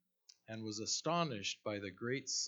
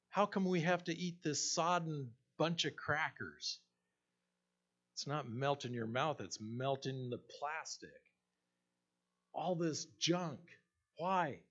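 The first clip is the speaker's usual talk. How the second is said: high whining complaint; whiny voice; 5 semitones higher than usual. Retold or imitated. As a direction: whine it out like a tired complainer. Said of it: "she said in her whiny voice".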